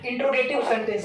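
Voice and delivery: इंट्रोगेटिव with "in-tro" run together; mispronounced interrogative. Retold or imitated. Teacher showing children's mistake, mispronounced इंट्रोगेटिव as in-tro-gative. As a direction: drop the middle syllable, in-tro-gative, in इंट्रोगेटिव. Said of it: "इसका उच्चारण करते हैं".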